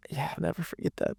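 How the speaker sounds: real grief barely holding together; fighting back real tears; choked up. Real, not acted. Recalling friend's hug after deaths.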